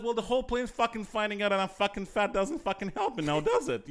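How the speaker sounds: hammed-up fury at a nosy passenger; retold furious retort. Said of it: "I was so furious".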